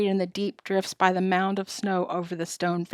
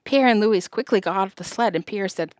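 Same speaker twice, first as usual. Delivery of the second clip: plain reading voice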